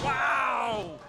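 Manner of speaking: loud amazed yell; excited shout. Real, not acted. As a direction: cheer the big splash. Reacting to a splash.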